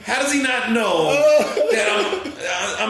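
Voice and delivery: amused he could not be told apart; laughing disbelief. Real, not acted. To the host, laughing that Trump fell for it.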